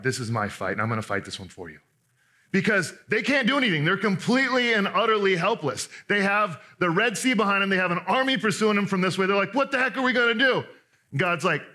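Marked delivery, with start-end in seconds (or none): 0.0-1.8 low, soft, reassuring promise; calm them like a protector taking over; a hint of God's gentle, steady voice. God comforts the trapped Israelites. 9.5-10.6 high mock panic; lightly hammed-up alarm; panicked Israelites. Israelites trapped at Red Sea.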